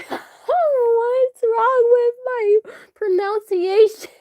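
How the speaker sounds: high, drawn-out laughing whine; mortified laughing wail. Real, not acted. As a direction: wail it slowly through embarrassed laughter. Flustered after a slip of the tongue.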